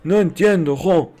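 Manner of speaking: ordinary talk, nothing marked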